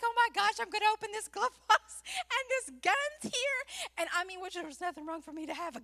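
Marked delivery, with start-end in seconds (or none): 0.0-3.6 high, loud, frantic retelling; acted panic. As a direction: voice climbing in hammed-up alarm. Retells panic at glove box.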